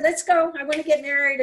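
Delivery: can't wait another second; eager bride. Acted. Hag urging Arthur to hurry to the wedding, eager and loud.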